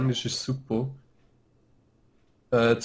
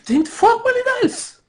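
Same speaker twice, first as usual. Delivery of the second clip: high, loud burst of excitement; excited; 6 dB louder than usual. Real, not acted. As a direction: blurt it out, fired up. Excited game banter.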